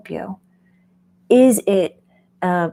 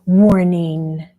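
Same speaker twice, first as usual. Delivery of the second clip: a dramatic, ominous "Warning."; mock-ominous "Warning" said louder; 6 dB louder than usual. Shown as a demonstration. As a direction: drop it like a solemn alarm. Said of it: "here comes my warning".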